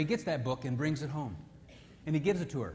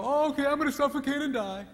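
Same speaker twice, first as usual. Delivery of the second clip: high, loud put-upon wife; mock wife voice; 9 semitones higher, 5 dB louder than usual. Preacher voicing a wife, high and loud. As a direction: whine like a wife gasping for air.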